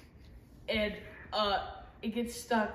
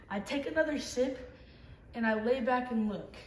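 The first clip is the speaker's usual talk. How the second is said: ordinary talk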